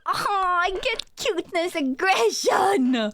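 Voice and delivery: high delighted shrieks over cuteness; excited squealing. Real, not acted. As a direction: squeal like you can't handle the cute. Squealing over cute character.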